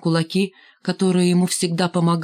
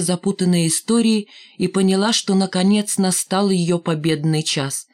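plain reading voice

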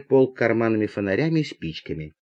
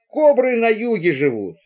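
higher, emphatic rebuttal; argumentative retort; 7 semitones higher than usual. Acted. Passenger disputing talk of cobras, argumentative retort. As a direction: snap back with pitch jumping up. Passengers argue about snakes.

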